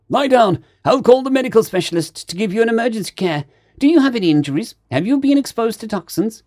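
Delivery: high, urgent space otter; alarmed. A narrator's reading. Space otter trying to save his suffocating crewmate, alarmed. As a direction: fire off emergency questions in a high voice. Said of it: "Perg grew alarmed for his safety".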